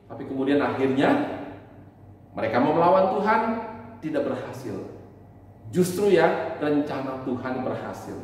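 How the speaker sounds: usual speaking voice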